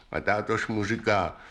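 usual speaking voice

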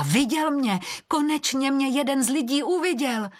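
high, excited cry of news; excited call. A narrator's reading. Perun returns to the guardian after being seen by a human, excited high call. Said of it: "Perun se vrací a volá".

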